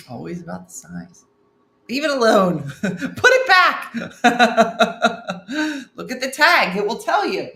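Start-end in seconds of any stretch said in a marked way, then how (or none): 1.9-3.9 hammed-up commands breaking into laughter; [name], emphatic. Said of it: "so emphatically as [name] just did". 4.0-5.9 loud, high burst of real laughter. Real, not acted. Cracks up at the comment. 5.9-7.5 loud, raised-pitch mock instruction; [name], emphatic. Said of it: "so emphatically as [name] just did".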